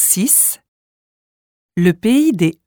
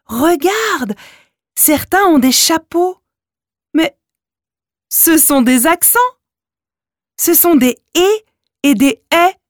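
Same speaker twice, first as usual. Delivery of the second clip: put-on child voice; 9 semitones higher than usual. A narrator's reading.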